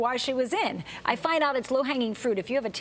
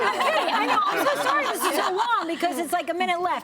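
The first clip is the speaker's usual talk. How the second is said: laughing; 8 semitones higher, 3 dB louder than usual. Real, not acted. Laughing through apology on air.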